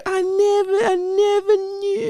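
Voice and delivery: very high, wailing impression; Tony Baker. Man joking about missing a crush, Tony Baker impression. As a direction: stretch it out in a mock tearful squeal. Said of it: "It'd be like Tony, Tony Baker".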